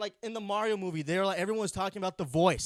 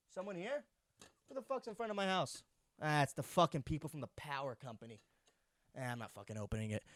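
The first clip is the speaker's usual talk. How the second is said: hushed muttering; 5 semitones lower, 10 dB quieter than usual. Real, not acted. Hiding from power company at door.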